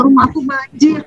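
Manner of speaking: a hint of a sorry tale; sad. A melancholic telling of her flooded house, mock sad. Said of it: "rumahnya banjir tuh sedih ceritanya".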